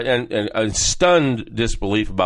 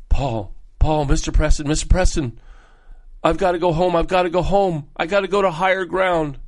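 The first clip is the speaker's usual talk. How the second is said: mimicking a panicked coworker, pitched up; frantic staff member; 5 semitones higher than usual. Staff member begging the principal to go home, frantic. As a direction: retell her frightened begging, a bit higher. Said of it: "came to me frantically".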